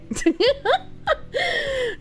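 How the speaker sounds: high, softening giggle fit; amused laughter. Real, not acted. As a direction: giggle helplessly at the message. Laughs at chat message.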